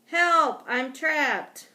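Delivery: high, loud cry for rescue; squeaky, urgent yell; yell for help. A narrator's reading. Trapped hamster calls out from under the bag, yelling. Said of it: "What I could do was yell".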